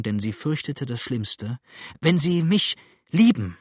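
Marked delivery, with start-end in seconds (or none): 2.0-3.6 louder, higher, desperate appeal; desperate pleading. A narrator's reading. Adele appealing to James's love, desperate pleading. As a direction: implore him, voice raised. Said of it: "flehte Adele jetzt in wilder Verzweiflung".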